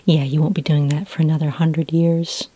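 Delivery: usual speaking voice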